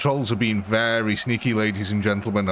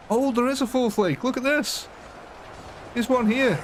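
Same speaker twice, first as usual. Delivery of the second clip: much higher-pitched, surprised spotting; delighted discovery; 11 semitones higher than usual. Real, not acted. Streamer spots the missing lake for viewers, high surprised delight. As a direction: pitch jumps up in real surprise.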